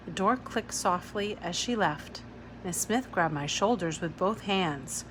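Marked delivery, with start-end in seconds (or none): none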